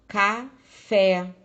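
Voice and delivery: café loud, split, FÉ punched; stress on last syllable. Shown as a demonstration. Teacher giving an oxítona example, café loudly stressed on FÉ. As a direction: call out café with a strong final FÉ. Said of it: "nós chamamos de oxítona".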